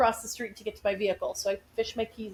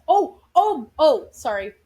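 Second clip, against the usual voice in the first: startled; 4 semitones higher, 7 dB louder, slower than usual. Real, not acted. Startled mid-story, apologizes.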